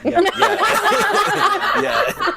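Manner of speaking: high giggling yeahs through laughter; can't stop laughing at the memory. Real, not acted. Laughing at her own sword story, giggling.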